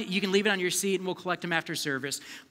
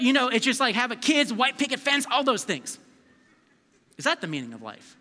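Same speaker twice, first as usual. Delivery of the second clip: ordinary delivery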